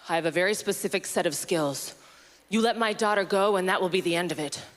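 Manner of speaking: low, deliberate action-film threat; growing absurd while keeping a menacing phone voice; deadpan low threat down the phone; Liam Neeson. Acted. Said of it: "Liam Neeson in Taken".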